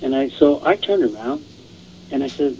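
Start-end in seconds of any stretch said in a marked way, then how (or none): none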